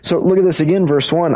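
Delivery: usual speaking voice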